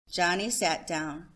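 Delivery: ordinary delivery, nothing marked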